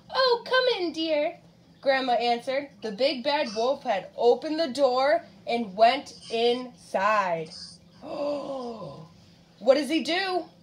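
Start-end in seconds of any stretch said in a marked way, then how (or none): 0.1-1.4 put-on high-pitched old granny welcoming a visitor; storybook grandma. A narrator's reading. 8.0-8.9 soft, drawn-in storyteller's gasp; dramatic gasp. Pretend. Dramatic gasp while reading to child.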